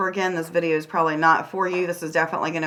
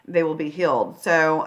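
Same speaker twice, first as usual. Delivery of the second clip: ordinary talk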